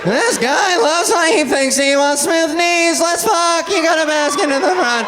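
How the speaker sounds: high, excited sitcom comedian voice; hammed-up comedian, stretching the words out; Seinfeld. Comic propositioning the catcaller, excited sitcom-star voice. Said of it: "I fucking sound like Seinfeld".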